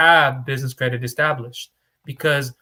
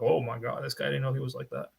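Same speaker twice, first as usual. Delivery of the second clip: much softer, quick gasp of mock surprise; mock shocked viewer; 8 dB quieter than usual. New viewer shocked by the broker's tone, softer mock gasp. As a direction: play a scandalised newcomer under your breath. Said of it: "tuning in and going like".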